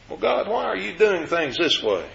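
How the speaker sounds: mock complainer. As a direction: voice the grumblers pestering God.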